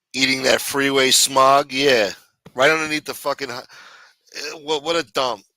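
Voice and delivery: ordinary talk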